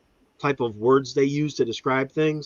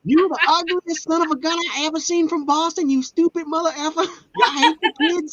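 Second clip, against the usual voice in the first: hammed-up hillbilly rant; mock Appalachian talker, pitched way up; Appalachian twang. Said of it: "You sound like Boomhauer".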